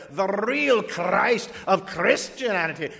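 slow, hammed-up Scottish accent; mock Scottish documentary narrator. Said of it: "a Scottish accent works even better".

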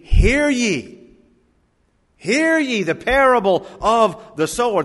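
high, loud ringing call; proclaiming. Acted. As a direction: proclaim it like a herald. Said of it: "Those are strong words".

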